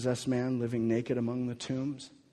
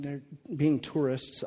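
ordinary delivery

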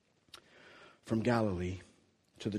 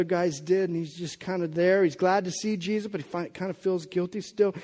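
preaching with rising force, louder as it goes; building sermon; 6 semitones higher, 5 dB louder than usual. Acted. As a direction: push the story toward the climax.

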